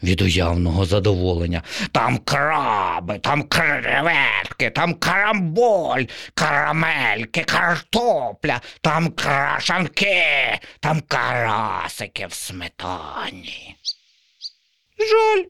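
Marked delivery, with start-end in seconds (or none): 1.9-13.8 slow, higher dreamy crow; greedy crow. Acted. Crow lists treats. 15.0-15.4 very high small sparrow sigh; squeaked softly. Acted. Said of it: "тихо пропищав горобчик".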